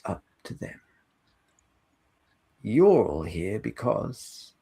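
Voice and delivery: measured, halting answer in character; train host in character. Acted. Train host answers passengers about the line, measured and halting. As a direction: explain it with long, deliberate pauses.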